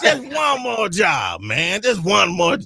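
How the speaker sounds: hammed-up pleading yell; begging shout. Replaying the caller, laughing.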